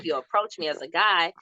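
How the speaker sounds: ordinary talk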